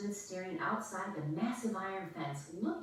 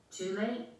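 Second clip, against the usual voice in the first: usual speaking voice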